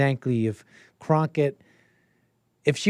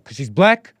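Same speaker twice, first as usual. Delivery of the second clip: tag a rising question onto the imagined reaction; imitated incredulous question; mock disbelief; 5 dB louder than usual.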